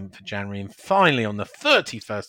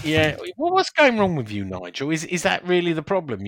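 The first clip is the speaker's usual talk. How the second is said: teasing; 7 semitones higher, 4 dB louder than usual. Real, not acted. Teasing Nigel about his score.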